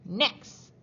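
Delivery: loud, curt call; snapped order. A narrator's reading. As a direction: snap it out. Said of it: "snapped Mister Kumbas".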